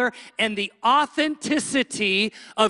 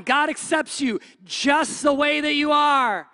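high, slow, ringing pulpit proclamation; raised preaching voice, words stretched out; preacher proclaiming; 5 semitones higher than usual. Acted. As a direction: proclaim it to the whole congregation. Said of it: "maybe I'm up here going".

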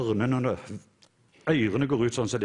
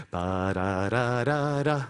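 drumming sound; much slower than usual. Shown as a demonstration. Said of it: "jeg vil du skal bare lage lyden".

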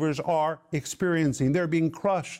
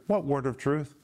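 ordinary talk, nothing marked

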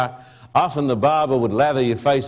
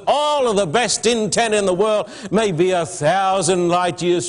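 raised, earnest sermon voice; preaching; 6 semitones higher than usual. Acted. Preacher urging his whole church, earnest preaching. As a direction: press the point home to the congregation.

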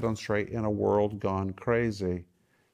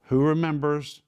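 usual speaking voice

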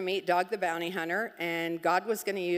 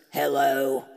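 low, loud mock-male hello; man answering phone; 7 semitones lower, 6 dB louder than usual. Guy answers the call from Bob's phone, low man's hello. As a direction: imitate a man picking up, deep and abrupt. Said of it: "and a guy answers".

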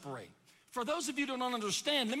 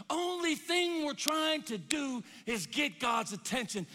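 raised, loud, driving sermon voice; full-force preaching; 6 semitones higher, 6 dB louder than usual. Acted. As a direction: preach it hard and high to the congregation.

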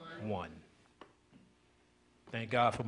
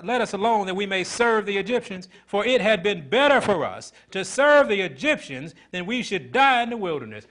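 higher and louder, rolling sermon cadence; preaching at full force; 7 semitones higher, 9 dB louder than usual. Acted. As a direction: preach it out to the congregation.

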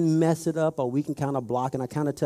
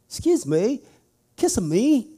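high, louder mock British accent; imitating a British tourist asking; 8 semitones higher, 4 dB louder than usual. Tourist at the 7-Eleven, in a mock British accent. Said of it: "with this strong British accent".

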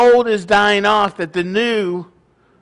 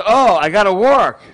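loud, higher-pitched mock dawning shock; sudden realization; 4 semitones higher, 5 dB louder than usual. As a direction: act out a young adult's startled realization. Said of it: "and they realize".

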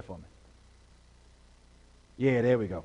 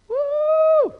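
high ringing woo; excited whoop; 3 dB louder than usual. Real, not acted. Pastor to church, high joyful woo. Pastor exclaims mid-sermon.

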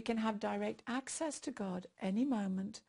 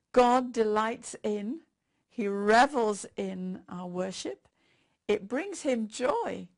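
slow, louder and deliberate; slow fervent emphasis; 6 dB louder, slower than usual. Real, not acted. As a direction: lean in on every word of delight.

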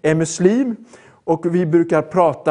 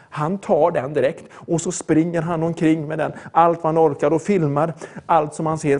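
usual speaking voice

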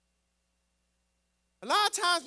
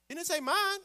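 ordinary talk

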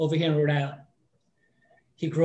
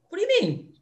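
surprised. Retold or imitated. [name] says mom talk went "okay.

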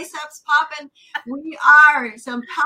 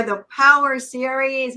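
ordinary talk, nothing marked

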